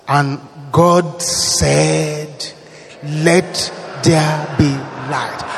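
very slow solemn pronouncement; booming biblical narration. Acted. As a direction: draw out God's words slowly.

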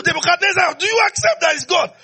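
high ringing shout of the question; calling shout. Acted. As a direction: shout the demand across the years. Preacher voicing God's yearly question.

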